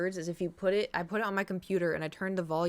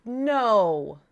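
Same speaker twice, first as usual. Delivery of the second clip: sharp no; 2 semitones higher, 8 dB louder than usual. Real, not acted. Dog bites her.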